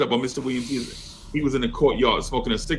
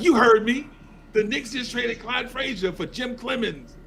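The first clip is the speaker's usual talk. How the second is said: blunt teacher; 11 semitones higher than usual. Retold or imitated. Teacher announcing trade.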